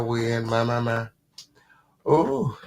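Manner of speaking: ordinary talk, nothing marked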